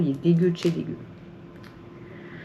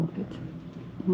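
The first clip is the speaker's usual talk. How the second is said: ordinary talk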